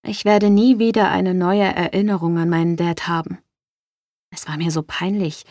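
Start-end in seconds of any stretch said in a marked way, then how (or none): none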